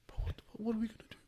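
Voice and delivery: fast, high whisper; panicked whisper. Retold or imitated. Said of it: "He's like".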